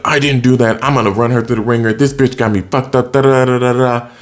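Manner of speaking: lightly voiced smug jerk brushing off blame; douchebag guy. AJ brushing off the accusation, lightly voiced cocky douchebag. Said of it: "playing a very convincing douchebag guy".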